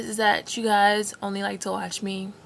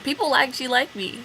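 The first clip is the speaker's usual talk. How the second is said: ordinary talk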